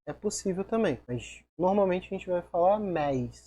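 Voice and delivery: ordinary talk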